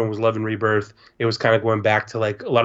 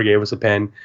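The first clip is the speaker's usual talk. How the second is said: normal talking voice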